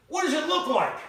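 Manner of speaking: loud, high call; yelling back. Retold or imitated. Wife shouting back from another room, loud and high. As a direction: holler back the question.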